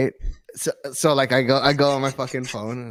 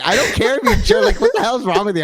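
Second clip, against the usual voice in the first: high-pitched retold scolding; hammed-up indignant telling-off through amusement; mock exasperation; 9 semitones higher, 3 dB louder, faster than usual. Husband retells his wife confronting him, mock exasperation.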